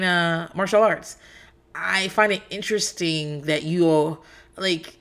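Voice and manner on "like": normal talking voice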